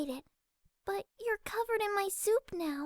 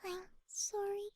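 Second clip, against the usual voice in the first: soft, barely heard apology; timid mumble; 8 dB quieter than usual. Acted. Fluttershy mumbling sorry to Rainbow, very softly. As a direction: say it so softly it slips away. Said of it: "speak louder".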